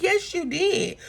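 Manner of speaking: a lightly voiced firm comeback; mock insistence. Porsha insisting to Dennis, in a lightly high mock-firm voice. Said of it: "She's like".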